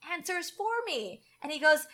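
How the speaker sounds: ordinary talk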